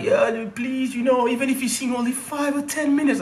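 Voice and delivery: high, whiny mock plea; hammed-up pleading promoter. Promoter begging the comedian for mercy, high mock whine. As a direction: beg like a crook putting it on.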